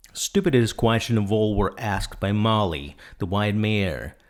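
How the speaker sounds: plain reading voice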